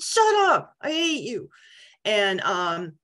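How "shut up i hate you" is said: high, squealed retort, loud at first; indignant squeal, played for laughs; mock outrage. Wife retorting to her laughing husband, mock outraged.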